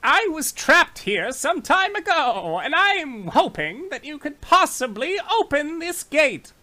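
high hopeful prisoner voice; satyr voice. Acted.

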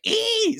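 very high-pitched "ee" squeal; scary creature screech. Retold or imitated. Telling how the shot snow creature sounded, mock scary screech. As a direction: imitate a snow monster's thin, high shriek. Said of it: "makes a scary, like".

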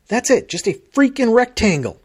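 loud, high exasperated complaining; exasperated rant. Real, not acted. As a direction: vent about it, worked up. Complaining rant about identical phones.

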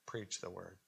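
very soft, low and understated; said lightly. Shown as a demonstration. Preacher showing how not to say the word preach, said lightly. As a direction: drop it to a small, hushed voice. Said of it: "I can't say it lightly".

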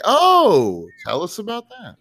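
high, mock-intrigued "oh"; imitating intrigued reaction. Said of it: "It sounded like you were like".

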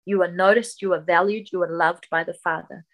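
ordinary talk, nothing marked

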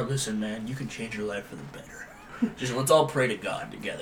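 low, soft, soothing preachy voice; hushed, earnest-sounding mock pep talk; mock gentle counsel. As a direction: imitate a tough guy turned tender. Suddenly gentle bounty hunter, contrasted.

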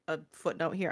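ordinary talk

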